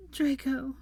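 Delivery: high, about to break into sobs; grief-stricken murmur; pitiful plea. A narrator's reading. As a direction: say it small and high, near tears. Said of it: "she repeated before breaking down".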